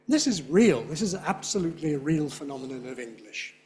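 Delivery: ordinary delivery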